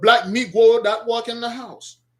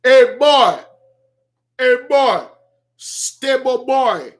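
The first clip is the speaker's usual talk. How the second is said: put-on loud calling out hey boy; imitating a master calling a slave; mocking slave master call; 3 semitones higher, 4 dB louder, slower than usual. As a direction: bark it like an old overseer.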